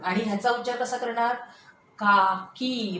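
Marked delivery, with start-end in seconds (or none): 2.0-3.0 काकी very slow, final ee held long; काकी with both vowels drawn long. Shown as a demonstration. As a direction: stretch काकी out, lingering on the long ee. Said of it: "आपला दीर्घ उच्चार झाला".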